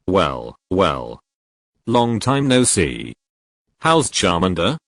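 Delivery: a touch of a sneer. Acted. Damien taunting the captured Ash, mild sneer. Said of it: "Damien sneered".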